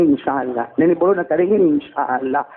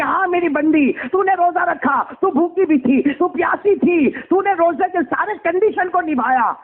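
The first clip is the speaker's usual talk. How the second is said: higher, commanding divine voice; God in majesty; 10 semitones higher than usual. Acted. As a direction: speak as a lofty, stern judge. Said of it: "اللہ تعالیٰ جلال میں کہیں گے،".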